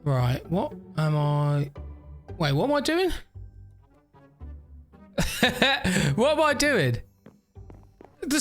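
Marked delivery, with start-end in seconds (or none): none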